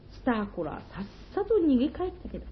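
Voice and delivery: ordinary delivery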